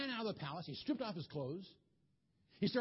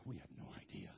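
whispered, softer, lower admission; sheepish whisper; 12 semitones lower, 12 dB quieter, faster than usual. Retold or imitated. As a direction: whisper it like embarrassed wise men. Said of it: "All those wise men go".

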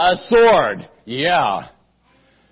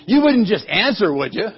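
plain reading voice

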